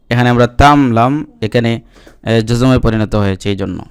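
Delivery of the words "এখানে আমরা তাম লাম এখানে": ordinary talk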